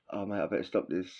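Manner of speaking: ordinary delivery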